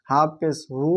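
ordinary talk

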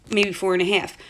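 normal talking voice

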